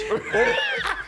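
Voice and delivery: a high, delighted laugh; high burst of laughter. Real, not acted. Laughing at own story.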